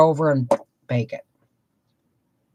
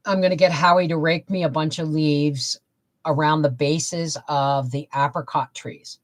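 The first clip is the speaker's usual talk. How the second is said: normal talking voice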